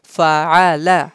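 Fa'ala as one joined word; said together. Shown as a demonstration. Teacher showing students how to join the letters, said together. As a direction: link fa, a, la smoothly into Fa'ala. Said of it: "We have to say them together".

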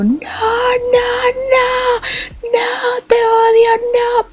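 very high, drawn-out wailing shriek; screaming. Retold or imitated. Friend crawling round the pool searching, screaming hysterically. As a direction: imitate a friend shrieking in despair.

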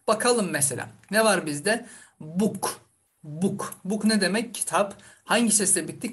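usual speaking voice

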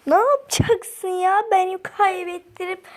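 angry outburst. Real, not acted. Girl yelling at a rival player who made her lose, angry. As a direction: yell at the player who ruined your run.

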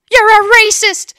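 loud accusing yell; accusing shout. Retold or imitated.

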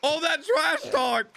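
high, fired-up exclamations; hyped shouting. Real, not acted. Viewer hyped that the fighter wants revenge, excited shouting. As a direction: crow excitedly at the loudmouth villain.

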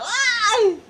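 loud, high-pitched shriek of pain; exaggerated high yelp; sudden yelp. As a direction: let out a piercing mock "ay". Girl yelps during the joke.

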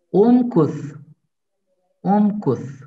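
unkus ending in th, tongue between teeth; lisped final th. Shown as a demonstration. Teacher modelling the lisp on unkus for students. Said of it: "peltek bir ses gitmesi gerek".

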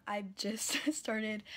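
ordinary delivery

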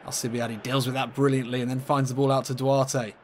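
ordinary talk

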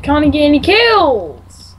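slow, loud drawn-out moan; exasperated gamer wail; frustrated whine. Real, not acted. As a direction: stretch the complaint out in frustration. Moaning about no kills.

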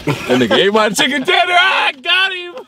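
high, laughing triumphant shout; excited laughing shout. Real, not acted. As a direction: gloat with a laugh. Playful prank, laughing shout.

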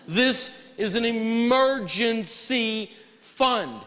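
"emergency" stretched out syllable by syllable, high; very slow, weighty stress on "emergency"; drawn-out emphasis. Real, not acted. Teacher hammers home what the fund is, stretching "emergency".

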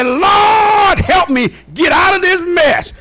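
high, drawn-out preacher's plea; desperate prayer, hammed up from the pulpit; pleading cry. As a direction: cry up to the Lord for rescue. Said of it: "you ought to be able to say".

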